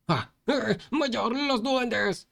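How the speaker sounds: high, slow alarmed cry; panicked shout. Acted. Fat boy warning the leader of goblins, panicked shout. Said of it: "el gordo gritó".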